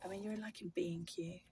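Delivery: hushed whisper so passers-by won't hear; whispering in the shop aisle. Real, not acted. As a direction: drop to a breathy whisper. Quiet so shoppers won't hear.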